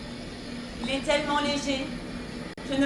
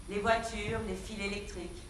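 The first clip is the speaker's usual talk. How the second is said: ordinary talk, nothing marked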